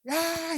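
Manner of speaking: mock baby yelp after the candy drops; baby's scream. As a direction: let out the baby's startled wail. Choking baby starts crying.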